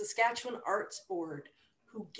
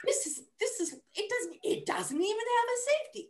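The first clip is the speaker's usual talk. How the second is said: alarmed disbelief. Retold or imitated. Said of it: "weighing it in my hand going".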